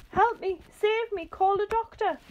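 high, loud panicked cries; shrinking man yelling for a doctor; yelled for help. A narrator's reading. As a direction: beg for rescue in terror. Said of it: "yelled Mister Twit".

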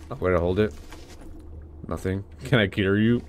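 ordinary delivery

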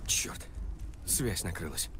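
whispering. Acted. Radio dies, whispered curse.

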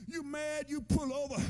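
ordinary talk, nothing marked

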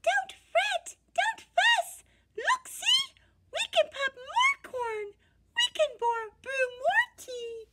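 very high, tiny squeaking voice; squeaky mouse. Acted. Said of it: "Mouse squeaks".

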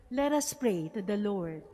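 ordinary talk

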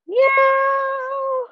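high, drawn-out meow; cat meow. Pretend. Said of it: "And cat".

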